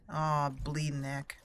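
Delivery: ordinary talk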